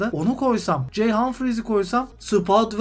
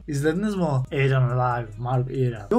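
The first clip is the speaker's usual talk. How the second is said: low-pitched storyteller impression; Murat abi; 6 semitones lower than usual. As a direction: imitate a commentator reminiscing in a low, level voice. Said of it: "falan tarzı anlatıyordu".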